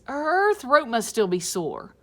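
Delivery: a lightly voiced bunch of kids; kids' voice.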